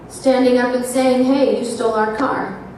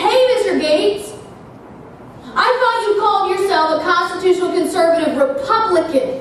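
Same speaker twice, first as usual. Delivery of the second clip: higher, fast call across the room; retold yell; 9 semitones higher, faster than usual. Calling after Gates at the door, mock yell. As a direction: call him out as he leaves. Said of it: "I yell at him".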